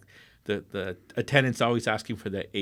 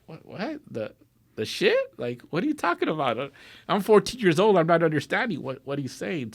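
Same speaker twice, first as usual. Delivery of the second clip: put-on confused teen; 7 semitones higher, 3 dB louder than usual.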